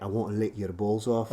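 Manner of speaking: put-on Scottish accent. Performing for friend who loves it, Scottish accent. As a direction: play a Scot talking dirty. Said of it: "You give me a Scottish accent".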